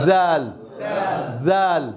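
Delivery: জাল with the tongue well out, th-like; exaggerated thin dhal. Shown as a demonstration. Teacher shows struggling learners, জাল with an exaggerated thin dhal. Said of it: "জিভ বেশ-, বেশি করে বাইর করুন".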